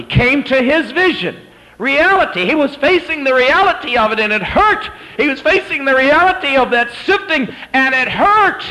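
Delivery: high, loud, impassioned preacher's cry; full-force preaching. Acted. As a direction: drive it home at the top of your voice.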